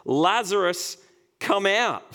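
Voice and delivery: high, loud summoning shout; loud call. A narrator's reading. Jesus calling dead Lazarus out of the tomb, loud high shout. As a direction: call him out of the grave with full voice. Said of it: "called out in a loud voice".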